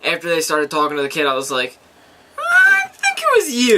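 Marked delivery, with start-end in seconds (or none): 2.4-3.8 high, drawn-out mock cry; exaggerated high-pitched put-on voice; mock reaction. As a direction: squeal it slowly, way up high. Quoting own reaction to the scene.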